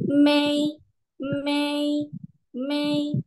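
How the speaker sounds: may, may, may. high and level; teacher drills tone one; high flat may. Shown as a demonstration. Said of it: "nadanya tinggi dan datar".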